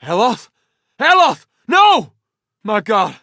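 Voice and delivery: high, loud shouts of alarm; anguished battle cry. Acted. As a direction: cry out for fallen comrades. Crying out as companions fall.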